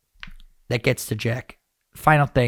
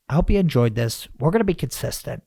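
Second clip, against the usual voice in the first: ordinary talk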